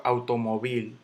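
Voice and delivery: "automóvil" stressed on the last syllable, au-to-mo-VIL; mis-stressed "automóvil" as automoVÍL; wrong final stress. Shown as a demonstration. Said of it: "Yo no digo".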